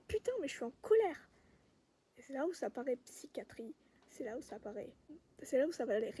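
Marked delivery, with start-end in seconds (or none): 0.1-1.3 high, loud cry of anger; exasperated, raised-voice venting; angry outburst. Acted. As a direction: blurt out the fury, pitch shooting up. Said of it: "L'autre partie va dire".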